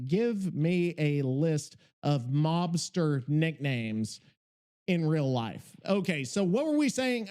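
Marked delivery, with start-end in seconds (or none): 0.1-5.8 slow, deliberate dictation to a voice assistant. Real, not acted. Dictating to ChatGPT, slow and deliberate. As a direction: spell it out slowly for the machine.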